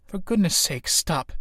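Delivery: ordinary delivery, nothing marked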